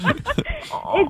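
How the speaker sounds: laughing through tears. Real, not acted. Caller to radio hosts, moved, laughing through tears. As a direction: laugh it out, overcome.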